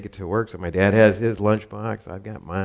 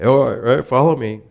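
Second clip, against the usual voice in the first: dad's voice; 5 semitones higher, 9 dB louder than usual. Retold or imitated. Dad voiced in story.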